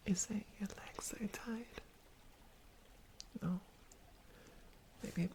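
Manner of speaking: soft, close whisper to the listener; ASMR whisper. Acted. To the rescued stranger, a soft reassuring whisper. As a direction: soothe them with a gentle hush.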